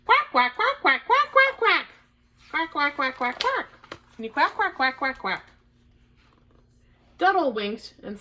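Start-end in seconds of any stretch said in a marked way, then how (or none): 0.1-5.4 high, repeated duck quacks; quacking. A narrator's reading. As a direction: quack away like a flock of ducks. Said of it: "quacked and quacked".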